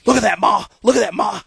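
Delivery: high, loud, thrilled; dad cheering the perfect game to his wife; excited dad. Retold or imitated. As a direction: share the big moment.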